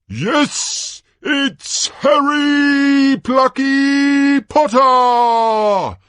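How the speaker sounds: very high, loud, stretched-out name; triumphant announcer shout. Acted. Announcer revealing Harry to the crowd, shouting with long held syllables. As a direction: bellow it like a ringside introduction.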